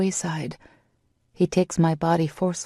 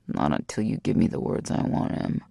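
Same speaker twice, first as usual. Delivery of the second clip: much lower, steady man; deep male reply; 11 semitones lower than usual. A narrator's reading. Lover refusing Em until she gives the words, low. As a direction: answer low and unmoved.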